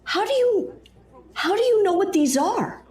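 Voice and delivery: high, loud startled demand; alarmed suspicion. Acted. Dana demands how the Doctor knows the capsules, high and alarmed. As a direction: jump on him, shocked he knows.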